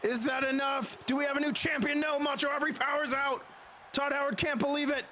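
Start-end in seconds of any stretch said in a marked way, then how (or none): none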